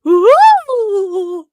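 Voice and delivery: loud, high crowd "ooh"; audience "ooh". Retold or imitated. Recapping the movie audience's reaction, high mock "ooh". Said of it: "you hear everyone in the audience going".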